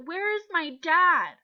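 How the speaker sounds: high, loud, slow anxious call; scared. Acted. Teacher voicing a pupil's alternative reading, scared. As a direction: call out for a missing parent. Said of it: "if you said".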